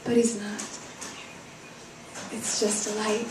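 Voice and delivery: soft, deflated poetic delivery; hushed after the climax; quiet letdown. A narrator's reading. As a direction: let the hope drain away quietly. Hope collapses after the cry.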